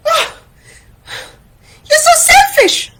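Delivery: squeaky, very high outrage; gasp, then accuse in a piercingly high voice; high-pitched wounded huff; Taín, offended. Acted. Friends quarrel in story.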